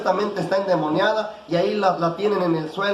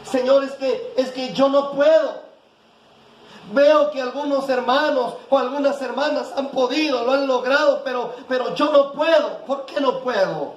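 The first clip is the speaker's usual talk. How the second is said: 1 pleading prayer; 6 semitones higher than usual. Retold or imitated. Imagined desperate prayer to God.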